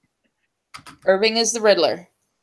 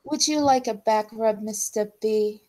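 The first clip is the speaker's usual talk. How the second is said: soft, flirty henchwoman voice; Harley Quinn; 3 semitones higher, 6 dB quieter than usual. Retold or imitated. Picking on a crush after stream, soft Harley Quinn voice. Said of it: "I'm doing the Harlequin voice".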